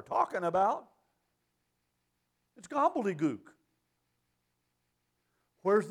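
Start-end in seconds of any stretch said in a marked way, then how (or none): none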